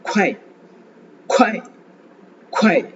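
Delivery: "குவாய்" as one quick syllable, strong airy k, falling; sounds joined together. Shown as a demonstration. Teacher showing students the blended sounds, one aspirated "குவாய்". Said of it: "சேர்த்து pronounce பண்ணும்போது".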